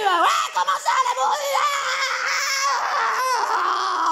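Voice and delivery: very high, slow, squealing indignant creature voice; outraged bestiole. Acted. Insulted bestiole protests to the coach, high outraged squeal. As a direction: shriek your offence like a prissy little creature.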